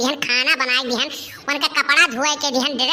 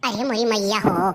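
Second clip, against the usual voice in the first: ordinary talk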